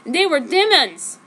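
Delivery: ordinary delivery, nothing marked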